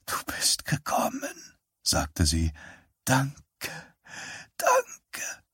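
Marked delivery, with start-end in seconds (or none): 0.0-1.6 put-on high breathless whisper of an old woman. Dying mother whispers that her son came. 3.0-5.4 high, softer, breaking into a cry; tearful thanks. Acted. Dying mother thanks her son, close to crying. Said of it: "Tränen begannen aus ihrem gesunden Auge zu strömen".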